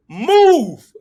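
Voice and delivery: high, loud yelled word; yelling. Retold or imitated. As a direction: shout it out urgently. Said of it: "you're hollering at me".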